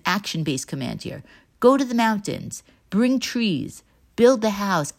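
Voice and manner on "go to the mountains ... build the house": raised-pitch, firmly stressed commands; emphatic demand. Acted. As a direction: give each command its own emphatic push. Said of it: "Chagai's very emphatic demand to the people".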